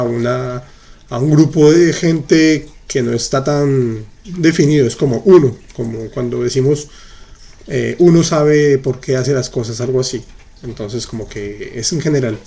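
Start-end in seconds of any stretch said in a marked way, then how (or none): none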